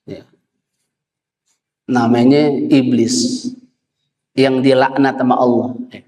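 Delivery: usual speaking voice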